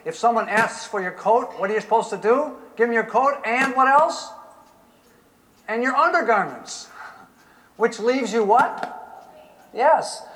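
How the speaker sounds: loud, high full-force preaching. Real, not acted. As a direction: preach it out to the crowd.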